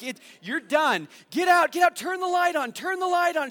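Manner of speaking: high, loud urgent yelling; husband shouting for wife to turn on the light; panicked shouting. Retold or imitated.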